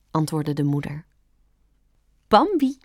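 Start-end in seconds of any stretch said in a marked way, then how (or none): 2.3-2.8 put-on high, excited young rabbit; exclaiming. A narrator's reading. Rabbit repeating the new fawn's name, exclaiming. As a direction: exclaim it high and bright-eyed. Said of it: "riep het konijn uit".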